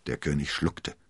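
plain reading voice

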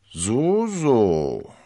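loud, high, drawn-out teasing; knowing scepticism. A narrator's reading. Athos hearing D'Artagnan's brief account, knowingly sceptical. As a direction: draw it out as if unconvinced.

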